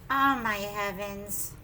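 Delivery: ordinary delivery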